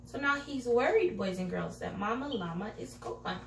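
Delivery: plain reading voice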